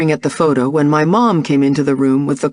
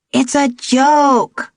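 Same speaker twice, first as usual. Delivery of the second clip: put-on little girl voice; 9 semitones higher, much slower than usual. A narrator's reading. Young daughter voiced by narrator.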